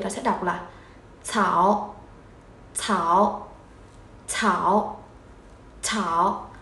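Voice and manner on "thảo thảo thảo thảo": "Thảo" low, dipping then rising; third tone. Shown as a demonstration. Teacher combining ao with tone three, "Thảo" dipped and rising. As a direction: dip "Thảo" down and lift it at the end. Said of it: "Kết hợp với thanh ba".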